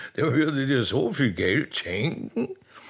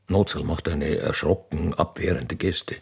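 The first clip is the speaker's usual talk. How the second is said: stage directions read; 9 semitones lower than usual. A narrator's reading. Narrator reads out the arrival of the royal train, stage voice. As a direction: announce the scene like a stage narrator.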